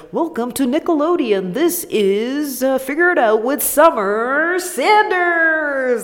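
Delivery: high, bright TV announcer voice, slowing at the end; host a Nickelodeon game show; kids TV host voice. Retold or imitated. Host imitating a Nickelodeon show announcer, upbeat. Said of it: "it was like".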